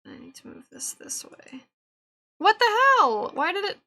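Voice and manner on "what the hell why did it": high, loud burst of disbelief; shocked outburst. Real, not acted. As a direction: yelp at the ruined edit. Sudden shock at broken edit.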